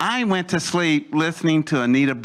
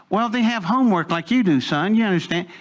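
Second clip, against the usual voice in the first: put-on higher-pitched talking down to a kid; condescending; 7 semitones higher than usual. Adult brushing off a junior high kid's question, condescending. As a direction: patronize the boy, pitched up. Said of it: "this is how they talked to you back then ... it's very condescending".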